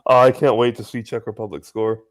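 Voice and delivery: usual speaking voice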